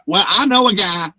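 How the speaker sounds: hammed-up attempt at [name]'s country accent; terrible [name] accent. Character says she knows someone who can help, in a terrible [name] accent. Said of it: "that was a terrible [name] accent".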